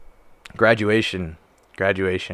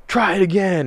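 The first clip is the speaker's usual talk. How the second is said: higher-pitched mock drill instructor; drill instructor order; 7 semitones higher than usual. Drill instructor shoving a recruit back down, mock stern. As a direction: imitate an instructor dismissing a recruit.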